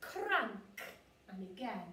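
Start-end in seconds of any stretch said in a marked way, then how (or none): 0.0-0.8 "Crank" raised in pitch, R rolled clearly; rolled R sound. Shown as a demonstration. Teacher modelling for children to copy, "Crank" with a strong R. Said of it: "it's that R sound".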